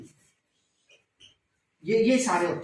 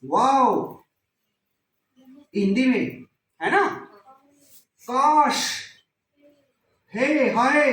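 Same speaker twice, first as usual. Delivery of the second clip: each interjection tossed out louder and with lift; exclamation demo; 2 semitones higher, 4 dB louder, slower than usual. Shown as a demonstration. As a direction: act out sudden surprise on every word. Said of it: "विस्मयादि बोधक sudden feelings and emotions". (अचानक भाव के साथ ज़ोर से उद्गार)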